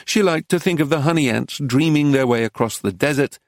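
plain reading voice

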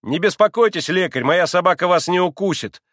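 high voice with an angry note; defiant schoolboy, pitched high; loud, cutting retort. A narrator's reading. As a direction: snap back insolently at the doctor. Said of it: "громко отрезал Коля".

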